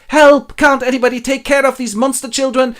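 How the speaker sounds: high, loud comic panic; panicked cry. Acted. Scrooge flees the monster children, mock panicked. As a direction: shriek for help, hammed up.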